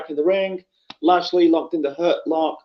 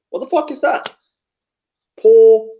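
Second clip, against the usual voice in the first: incredulous outburst; 5 semitones higher, 6 dB louder than usual. Real, not acted. Ranting about match booking.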